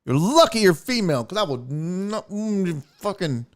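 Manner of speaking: holding back rage, words breaking off; mock strangled fury with grunts; acted restrained anger. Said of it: "pull it back and be like".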